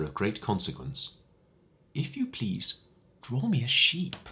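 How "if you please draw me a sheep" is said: little prince voice, words drawn out slowly; higher child's voice, very slowly and seriously; slow, grave request. Acted. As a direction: ask as if it matters enormously. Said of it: "he repeated very slowly".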